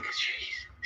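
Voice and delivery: breathy whispered aside, barely audible; a startled whisper to yourself; whispered jeez. Retold or imitated. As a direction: let the jeez out as a hushed breath. Whispered reaction to a wake-up moment.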